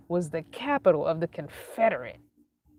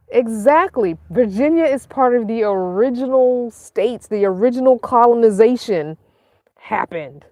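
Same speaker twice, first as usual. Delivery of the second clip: loud, higher-pitched indignant rebuttal; raised voice, insisting on being right; emphatic protest; 5 semitones higher, 10 dB louder than usual. Real, not acted. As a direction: argue the point forcefully at high volume. Indignantly rebutting Virginia's exclusion.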